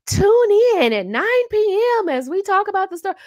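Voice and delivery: high, breathy true-crime teaser; TV promo voice. Retold or imitated. Mocking a true-crime TV show promo. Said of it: "those shows where you're like".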